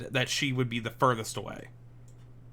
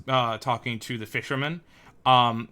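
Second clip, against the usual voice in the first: ordinary talk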